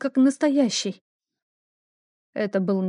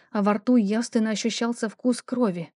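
ordinary delivery, nothing marked

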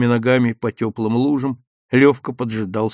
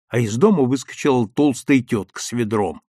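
plain reading voice

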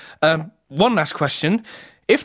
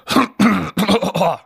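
throat clearing. Acted. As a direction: clear the throat before a take. Clearing throat before retake.

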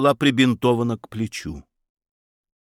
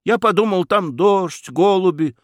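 put-on much higher-pitched young man's explanation; flustered explaining; 9 semitones higher than usual. A narrator's reading. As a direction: start explaining yourself in a high voice. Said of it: "начал пояснять Федяшев". (оправдываясь высоким голосом)

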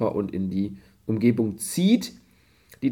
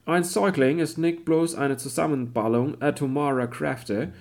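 German read in a put-on English accent; a lightly faked English accent on German; pseudo-English accent; 4 semitones higher, 4 dB louder than usual. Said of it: "redest mit pseudo-englischem Akzent".

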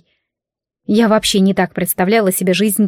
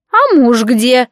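put-on old hag, no threat; 3 semitones higher, 3 dB louder, slower than usual. A narrator's reading. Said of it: "уже без угрозы в голосе спросила она".

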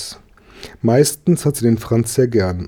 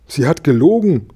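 put-on higher, louder little boy's squeak; squeaky protest; 6 semitones higher, 5 dB louder than usual. A narrator's reading. Franz protests to the boy about Gabi, squeaky. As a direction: pipe up in high-pitched indignation. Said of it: "piepste der Franz".

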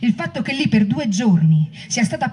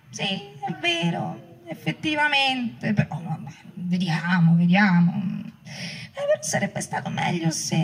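slow, drawn-out, soft, hesitant mock voice; imitating a wishy-washy politician, much softer and slow; mocking hedging; 6 semitones higher, 6 dB quieter, slower than usual. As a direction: parody a leader dodging, slowly and feebly. Mocking leaders' hedging replies.

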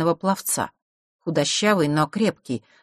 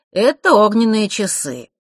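slow, loud, higher presentation; showy, over-polite explanation; courteous explaining; 5 semitones higher, 7 dB louder, slower than usual. A narrator's reading. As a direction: explain obligingly, stretching the words. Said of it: "любезно разъяснил Марк".

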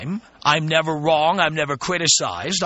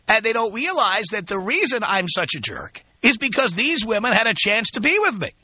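usual speaking voice

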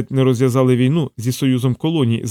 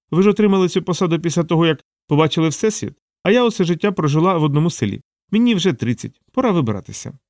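higher-pitched voice of a frank assistant; Savitri, candid assistant; 5 semitones higher than usual. Acted. Assistant justifying to her boss why she is leaving, frank higher voice. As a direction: pitch it up, making her case.

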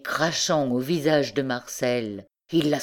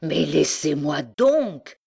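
cried out; slower than usual. A narrator's reading. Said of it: "cria-t-il".

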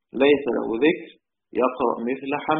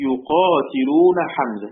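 slow, louder chant of يقاتلون حمزة; slow verse recitation; 4 dB louder, much slower than usual. Shown as a demonstration. Teacher reading the Shatibiyya line aloud, drawn out. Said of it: "قال".

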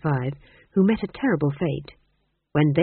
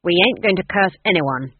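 firm, louder stern refusal; firm old witch; 5 dB louder than usual. A narrator's reading. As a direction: put your foot down as the old witch. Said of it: "said Granny firmly".

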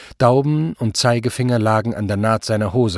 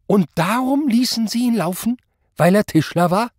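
high-pitched accusing question; incredulous anger; 11 semitones higher than usual. A narrator's reading. Superior questions Zott's decision, angrily incredulous. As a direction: throw the excuse back at him.

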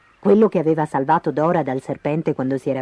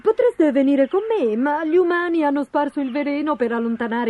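higher-pitched big bird voice; chatty emu; 6 semitones higher than usual. Acted. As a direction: explain chattily in an emu's voice. (emù chiacchierone, voce alta)

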